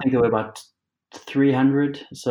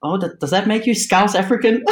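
high-pitched mock Scouse question; Scouse impression on the edge of laughter; Scouse accent; 11 semitones higher, 3 dB louder than usual. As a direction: imitate the Scouse local, pitched way up. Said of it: "in his proper Scouse accent".

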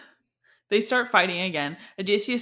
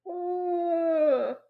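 groan of dismay; 10 semitones higher than usual. Real, not acted. Reacting to a sad scene.

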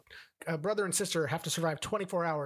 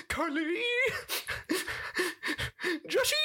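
hammed-up crying wail, very high; Nick Cage breaking down. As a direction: wail the names like a mock breakdown by the door. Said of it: "him breaking down, crying on the floor".

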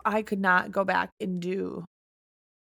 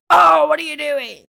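loud, high mock outrage at a driver; imitated yelling; 7 semitones higher, 9 dB louder than usual. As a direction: yell it like an exasperated passenger. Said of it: "yelling at, like".

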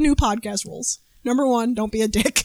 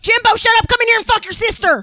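put-on shrill yell at a kid; 8 semitones higher, 5 dB louder than usual. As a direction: bark it at Jimbo. Yelling at kid in bit.

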